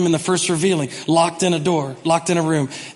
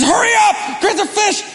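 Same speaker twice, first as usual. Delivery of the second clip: mock panicked shouting. Peter screaming at the crew to bring the boat, high frantic shout. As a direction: bark at the crew to hurry. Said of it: "he's screaming".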